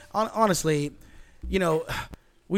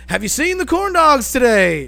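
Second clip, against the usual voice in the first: put-on high, loud, dotty old-man voice; confused old Biden; 8 semitones higher, 11 dB louder than usual. Podcaster mocks Biden answering Obama's earpiece with a high loud confused blurt. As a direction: blurt it out like a lost grandpa. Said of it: "And Joe Biden's like".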